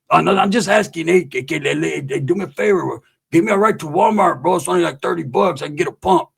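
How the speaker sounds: lightly voiced pestering homeboy; mock wheedling for a ride; homeboy impression. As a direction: stammer through asking a favor as the homeboy. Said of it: "he'll pull up on you".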